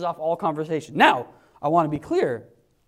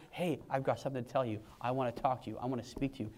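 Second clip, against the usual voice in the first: softer, lower, tender invitation; gentle God voice; 6 semitones lower, 11 dB quieter, faster than usual. Pretend. Preacher voicing God speaking gently to him, soft. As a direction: speak it softly like a caring friend.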